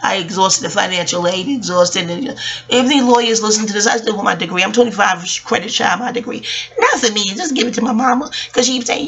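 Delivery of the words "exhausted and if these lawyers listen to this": normal talking voice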